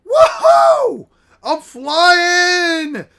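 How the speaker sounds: high, loud shout of joy; drawn-out, high-pitched thrilled yell; exhilarated whoop. Acted. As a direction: whoop as if soaring through the air. Character soars through the air.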